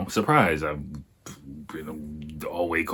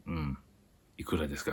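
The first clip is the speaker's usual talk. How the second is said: softer, embarrassed question; sheepish, quiet; 3 semitones lower, 12 dB quieter, much faster than usual. Retold or imitated. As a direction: ask the price meekly. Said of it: "I feel like a piece of shit".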